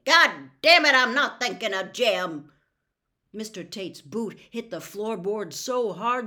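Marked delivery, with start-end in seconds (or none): 0.0-2.5 loud, much higher angry shout; furious outburst. Acted. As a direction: explode at him. Tate explodes at Atticus.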